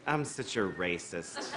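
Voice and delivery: low, slow mock-sheepish chicken. Comedian voicing a chicken, low and slow. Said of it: "the chicken was like".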